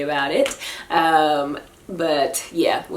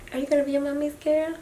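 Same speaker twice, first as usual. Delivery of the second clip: higher, fast, softer coo; 7 semitones higher, 6 dB quieter, faster than usual. Real, not acted. Mother asks baby girl in soft baby talk.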